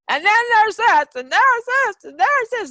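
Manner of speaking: high, loud, piling-up outburst of grievances; exploding rant. Retold or imitated. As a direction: unload every old grievance in one burst. Acting out a gunny-sack blow-up.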